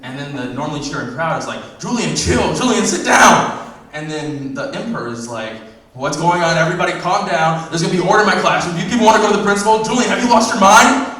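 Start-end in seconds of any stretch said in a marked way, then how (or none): 1.8-3.7 loud crowd yelling his name; crowd shouting. Acted. Crowd yelling at dreaming gladiator. 5.9-11.1 loud, high scolding emperor; furious emperor shouting down the arena; angry emperor. Acted.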